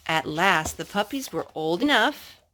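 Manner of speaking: plain reading voice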